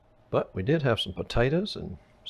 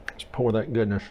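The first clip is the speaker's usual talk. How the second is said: ordinary talk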